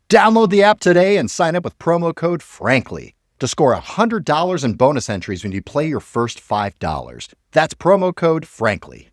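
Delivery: upbeat sponsor ad read. Acted. Host pitching the app to listeners, ad read. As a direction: sell the promo code like a radio spot.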